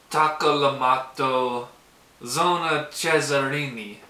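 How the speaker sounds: put-on slow, drawn-out Italian accent. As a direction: put on an Italian accent, stretching each word. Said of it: "Like my Italian accent?".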